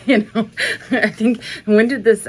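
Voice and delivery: laughing through "you know". Real, not acted. Amused aside to viewers, laughing. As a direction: chuckle through the words.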